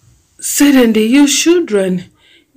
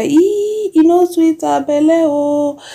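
mock high exclaim; 7 semitones higher, slower than usual. Mock exclaiming about wife.

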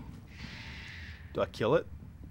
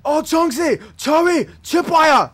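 shouting; 13 semitones higher, 10 dB louder, slower than usual. Real, not acted. Calling out enemies in alarm.

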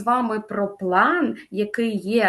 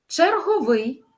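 "Черговий" higher, stressed on ВИЙ; stress on final syllable; 7 semitones higher, slower than usual. Shown as a demonstration. Teacher models correct stress, "Черговий" with final stress. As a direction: land high on the end of "Черговий". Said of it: "правильний наголос".

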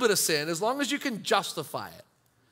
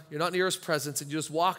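ordinary delivery

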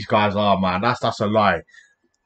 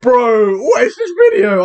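excited disbelief; 12 semitones higher, 4 dB louder than usual. Real, not acted.

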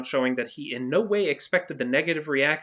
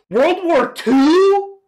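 much higher, louder, slow disbelief; stunned, stretch it out; shocked; 13 semitones higher, 11 dB louder, much slower than usual. Real, not acted. Shocked by the reference.

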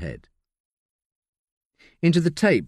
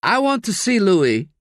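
a hint of a higher, insistent man; lightly voiced Curtis; 9 semitones higher, 4 dB louder than usual. Curtis demanding to see Louis. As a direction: demand it flatly.

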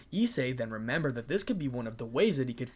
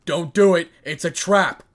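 loud, raised warning shout; much louder, higher cry of alarm; urgent warning; 5 semitones higher, 14 dB louder than usual. A narrator's reading. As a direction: call out to stop someone at once. Character warns his host of danger.

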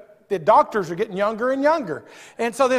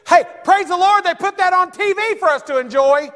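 high, loud joyful praise; praise shout; 9 semitones higher, 5 dB louder than usual. Real, not acted. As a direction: lift the congregation with it. Preacher praising God.

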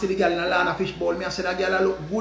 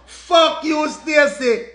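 cussing out; 7 semitones higher, 5 dB louder, much slower than usual. Retold or imitated. Cussing out Stacy.

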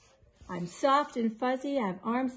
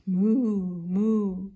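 mooing like a cow, louder; 6 dB louder than usual. Retold or imitated. Grandma playing the cow for the grandkids, loud mock moo. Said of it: "I say".

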